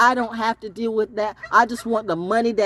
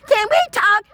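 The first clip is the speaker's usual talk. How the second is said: pleading caller; 12 semitones higher, 4 dB louder than usual. Acted. Voicing caller she rejects.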